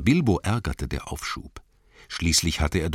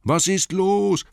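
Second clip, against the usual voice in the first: raised, high, drawn-out call; 5 dB louder, slower than usual. A narrator's reading. Bilbo asks the wailing Gollum what's wrong, calling out. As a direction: call into the dark, louder and higher. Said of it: "rief Bilbo".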